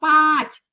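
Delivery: पाँच with nasalized aa, loud; nasal vowel. Shown as a demonstration. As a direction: let the aa of पाँच go through nose and mouth. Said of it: "सही शब्द क्या है?". (सही उच्चारण पाँच)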